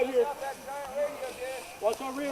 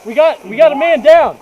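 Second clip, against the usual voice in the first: loud urgent alarm to the squad; man down shout; 14 dB louder than usual. Real, not acted. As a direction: yell it so everyone hears. Teammate falls in firefight.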